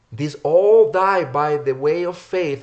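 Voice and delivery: loud, slow declaimed verse; emphatic scripture reading. Acted. Preacher reads Hebrews eleven aloud, loud emphatic scripture reading. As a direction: ring out the verse, stretching it.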